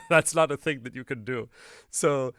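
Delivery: breaking into laughter while explaining; laughing. Real, not acted. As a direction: say it through a laugh. Laughs at the impossible demo.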